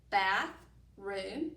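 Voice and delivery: Bath, room as two separate words with a pause; split into parts. Shown as a demonstration. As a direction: say each part apart. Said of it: "when I put it together".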